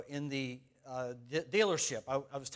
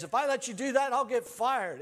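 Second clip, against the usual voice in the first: high, loud worried pleading; alarmed protest; 8 semitones higher, 6 dB louder than usual. Retold or imitated. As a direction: plead with her not to cut in. Attendant scolds lady cutting line.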